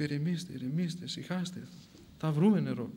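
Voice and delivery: soft, hushed reassurance; calm them down gently and quietly; soothing. Acted. Saint to his thirsty captors, soothing.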